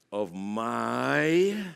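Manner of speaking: "my" held long and rising; emphasis on my. Shown as a demonstration. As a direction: stretch out "my". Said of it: "put emphasis on that word".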